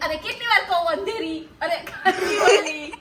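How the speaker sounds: exasperated, with a laugh creeping in; mock exasperation. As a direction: protest loudly, stretching the place name. Exasperated at repeated questions, corrects herself.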